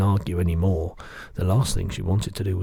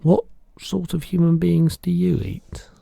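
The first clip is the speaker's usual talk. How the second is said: put-on hushed, frightened girl's whisper; trembling; 10 semitones higher, 4 dB louder than usual. Girl asking the giant, trembling whisper. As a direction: ask it trembling with fear. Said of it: "she asked, trembling".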